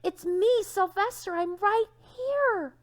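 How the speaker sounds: high, loud cry to his parents; calling out with all his heart; wanting to shout. A narrator's reading. Donkey calling to his parents, loud and high. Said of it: "How he wanted to shout".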